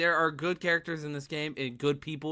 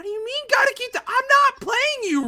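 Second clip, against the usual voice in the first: squeaky, loud, higher mock outrage; high-pitched; 10 dB louder than usual. Mock-indignant at rival player.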